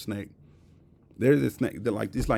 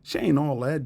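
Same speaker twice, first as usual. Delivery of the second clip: higher-pitched mock disdain; put-on scornful disbelief; mock catty hater; 5 semitones higher than usual. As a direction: imitate someone jealously putting a girl down. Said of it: "This is how they look at you".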